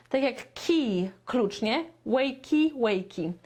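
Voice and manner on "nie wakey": usual speaking voice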